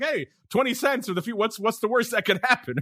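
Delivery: breezy retold pitch. Host imitating his friend tempted by cheap options, breezy. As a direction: toss it off as a mock-casual dare.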